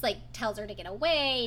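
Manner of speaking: normal talking voice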